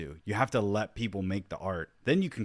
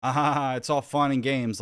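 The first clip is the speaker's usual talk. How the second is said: dismissive mock; 5 semitones higher, 6 dB louder, slower than usual. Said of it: "when people are trying to be".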